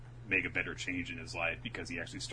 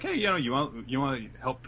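mock friendly voice; 7 semitones higher, 5 dB louder than usual. Mocking quote of pushy classmate.

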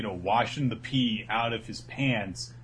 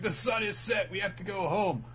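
put-on spooky kid warning; 7 semitones higher than usual. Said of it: "Chaco is like".

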